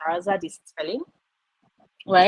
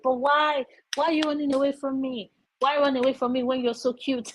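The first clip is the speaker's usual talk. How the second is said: higher pleading whine at the cat; mock whiny plea; 7 semitones higher than usual. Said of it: "and I'm like".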